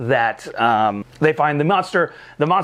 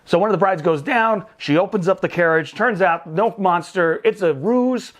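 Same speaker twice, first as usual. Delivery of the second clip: ordinary talk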